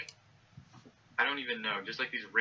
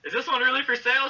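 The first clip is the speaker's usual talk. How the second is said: high, loud excited question; retold yell to a cashier, thrilled; enthusiastic yell; 14 semitones higher, 7 dB louder, faster than usual. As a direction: call it out high with eager excitement. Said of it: "I yell to the cash register".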